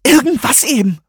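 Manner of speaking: hoch und laut angefaucht; fauchend. A narrator's reading. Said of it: "fauchte sie". (hohes, lautes Fauchen)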